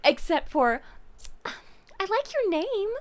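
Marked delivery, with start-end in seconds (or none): 1.4-2.9 high-pitched, sweet imitation of a sister; high little voice grasping for a compliment; sister's awkward compliment. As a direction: imitate her trying hard to compliment, pitched up. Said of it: "I'm gonna try to compliment this lady".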